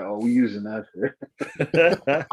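slow amused drawl. Retold or imitated. Adopting the joke.

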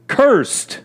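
"cursed" pitched sharply high, falling away; emphasis on cursed. Real, not acted. Preacher reading God's sentence on the serpent, "cursed" emphasized high. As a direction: punch "cursed" as one high, drawn-out word. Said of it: "I'll put the emphasis on the word cursed".

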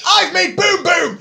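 put-on high, loud, slow baby shout; mock shout. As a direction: yell it like a proud toddler. Shouts the date's line.

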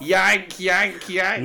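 high, loud, playful yanking; mock Tom. Host mocks his co-host, high and loud. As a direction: ham up someone heaving a crowbar.

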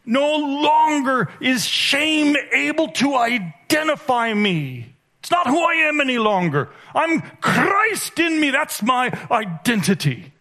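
high, ringing proclamation building to a shout; triumphant declaration. Real, not acted. Preacher proclaiming freedom from shame, high and exultant. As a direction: proclaim it with rising fervour.

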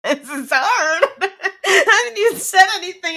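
giggling through the words; can't stop laughing while talking. Real, not acted. Nervous about reading aloud.

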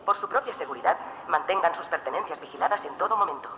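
airport announcement. Acted. Airport announcements before travel segment.

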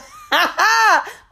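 loud, high burst of disbelieving laughter; high incredulous laugh. Real, not acted. Laughing at boyfriend acting chill after stranding her. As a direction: crack up at his nerve.

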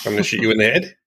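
Australian accent with the last syllable going up. Shown as a demonstration. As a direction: demonstrate an Australian accent, lifting the final word. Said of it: "tell an Australian accent because the last syllable goes up".

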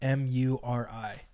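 slow spelling, one letter at a time. Real, not acted. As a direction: spell it slowly and distinctly for the listener. Spells a name out on request.

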